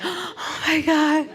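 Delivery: put-on overwhelmed child seeing all the food; high wide-eyed wonder; kid at a buffet. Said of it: "you're like a little kid".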